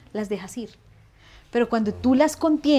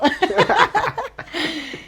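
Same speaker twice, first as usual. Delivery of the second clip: big laugh; 6 semitones higher, 5 dB louder than usual. Real, not acted. Laughs at her own joke.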